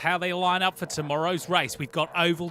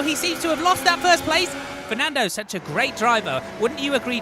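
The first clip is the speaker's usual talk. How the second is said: high, loud race call; motorsport commentator; 11 semitones higher, 6 dB louder than usual. Acted. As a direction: call the start like live TV.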